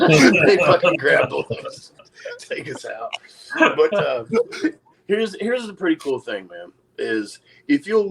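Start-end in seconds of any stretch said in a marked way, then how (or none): 0.0-3.1 laughing through the words; can't keep from laughing as he tells it; slow, breaking up with laughter. Real, not acted. Laughing while telling hug story.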